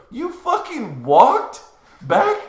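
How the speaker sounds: slow question swooping high at the end; retold incredulity. Friend hearing about the long walk, incredulous. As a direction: draw it out in mock disbelief.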